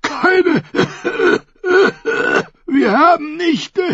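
words swallowed by coughing. A narrator's reading. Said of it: "ehe der Husten seine Worte verschlang".

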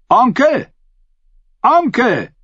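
high, sharp call to uncle; ringing, high-pitched hail. A narrator's reading. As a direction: call out across a noisy crowd. Calling out over the tumult.

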